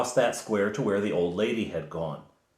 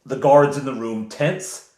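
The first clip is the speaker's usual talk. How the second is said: ordinary talk, nothing marked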